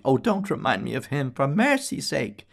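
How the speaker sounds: high, pained plea; a young barmaid's squealing plea; pleading prayer. A narrator's reading. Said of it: "grimaced and prayed".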